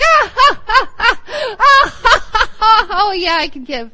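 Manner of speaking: loud, high, hammed-up cackling laugh into a giddy line; mock hilarious laughter. Preacher mocking a hilarious giver, loud fake laughter. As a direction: overdo a silly belly laugh, mocking the idea. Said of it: "I was trying to kinda do that before".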